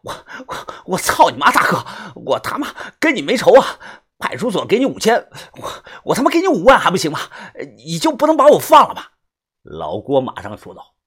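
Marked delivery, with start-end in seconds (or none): 0.0-9.0 rising, tearful begging, louder toward the end; whining plea. A narrator's reading. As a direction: wail and bargain desperately. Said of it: "这个人哭丧着脸".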